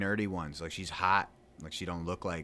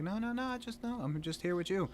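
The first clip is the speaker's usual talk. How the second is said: high defensive reassurance; 13 semitones higher than usual. Retold or imitated. Reassuring jealous girlfriend.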